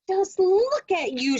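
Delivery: high, loud cross scream. Acted. Missus Wishy-Washy screams at her muddy animals, high and loud. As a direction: scold the muddy animals at full pitch. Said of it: "she screamed".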